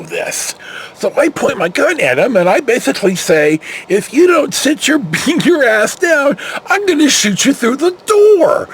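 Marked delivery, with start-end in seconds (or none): 3.9-8.7 retold scream. Cop retelling his yell at a burglar, high shout. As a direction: yell it like you mean to shoot. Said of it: "I'm screaming at the top of my lungs".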